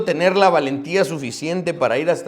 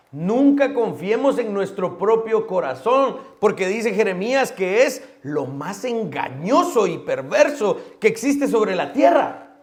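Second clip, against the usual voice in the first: higher, stronger warning from the pulpit; rising preaching; 7 semitones higher, 5 dB louder than usual. Real, not acted. Preacher warns his congregation not to trust their hearts, rising urgency. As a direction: lift the warning to the whole congregation.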